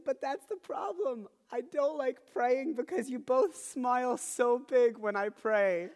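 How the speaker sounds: put-on slow, high, loud little-kid complaint; mock tearful child. Daughter complains to dad about smiling, imitated tearful wail. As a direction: imitate a child wailing her grievance. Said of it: "Seriously, tears are, like, legitimately a part of this".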